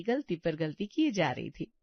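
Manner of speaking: ordinary delivery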